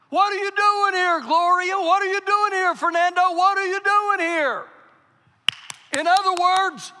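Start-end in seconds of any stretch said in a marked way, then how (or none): 0.1-4.6 high, loud, pressing question; loud preaching. Acted. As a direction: call out each name loud and high. Preacher applying question to congregation.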